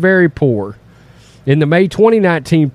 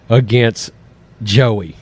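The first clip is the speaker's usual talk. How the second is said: ordinary delivery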